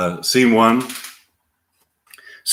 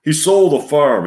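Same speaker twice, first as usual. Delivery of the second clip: angry; 6 semitones higher, 5 dB louder than usual. Retold or imitated. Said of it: "is angry".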